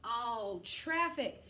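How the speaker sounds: very slow, drawn out; slow test read. Shown as a demonstration. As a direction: hold each word for the kids. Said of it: "Let's check".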